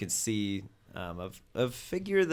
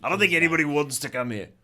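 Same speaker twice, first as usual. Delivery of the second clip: much higher, louder gang minion calling his boss; rough minion voice; 5 semitones higher, 10 dB louder, faster than usual. Acted. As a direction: shout it up to the boss like an eager henchman. Dez answers on radio.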